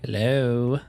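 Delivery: usual speaking voice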